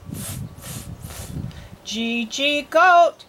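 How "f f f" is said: soft voiceless "fff" puffs for F; quiet phonics puffs of f; letter sound. Shown as a demonstration. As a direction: make just the sound of f, no voice. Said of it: "I wanna hear you say it".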